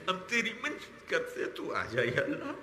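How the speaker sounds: real sobs choking the plea; tearful begging. Real, not acted. Preacher begs Allah to come, tearful and choked. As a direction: beg through tears, voice catching. Begs God while weeping.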